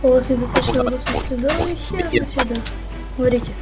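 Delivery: normal talking voice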